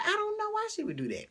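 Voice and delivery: telling a friend about the betrayal; exasperated. Retold or imitated. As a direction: whine it, fed up and baffled. Exasperated reply on phone.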